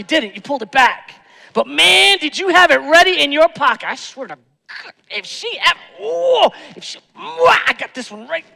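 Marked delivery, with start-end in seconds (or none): 1.5-3.9 high, loud, excited exclaim; loud, high exclamation from the pulpit. Real, not acted. Excited emphasis. 3.9-8.4 acted seething spouse. Acting angry spouse ready line.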